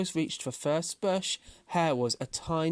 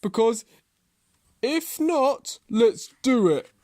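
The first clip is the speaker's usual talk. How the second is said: loud, raised voice with deliberate breaks; challenging tortoise; 10 semitones higher, 8 dB louder than usual. A narrator's reading. Tortoise presses Hare to race, loud and determined. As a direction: press the dare home firmly.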